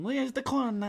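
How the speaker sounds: very high, loud leprechaun squeak; hammed-up tiny Irish imp voice. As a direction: pipe up like a cartoon leprechaun. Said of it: "like, a leprechaun kind of thing".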